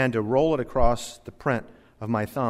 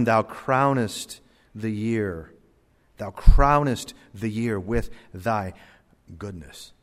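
slow, deliberate verse reading; scripture reading; 3 semitones lower, slower than usual. Real, not acted. Reading a Bible verse aloud.

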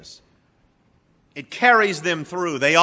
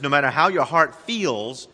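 ordinary talk

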